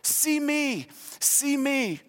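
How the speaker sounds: put-on high, soft little-kid voice; childlike plea. Adult pleading to the world, in a toddler's high little voice. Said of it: "walking this world going".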